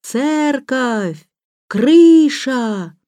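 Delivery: high, drawn-out cries, word by word; slow, loud shouting. A narrator's reading. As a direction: holler each word separately across a distance. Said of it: "так громко, как только мог, медленно, с расстановкой". (громкий крик с расстановкой)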